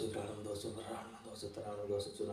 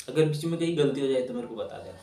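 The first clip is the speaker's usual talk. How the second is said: usual speaking voice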